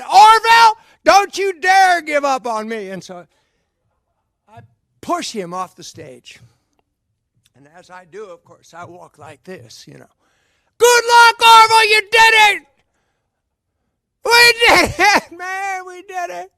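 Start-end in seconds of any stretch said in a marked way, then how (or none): 0.0-2.9 high, loud rallying yell; heroic shout. Acted. 10.8-16.5 very high, loud victory yell fading into a laugh; triumphant cheer. Acted.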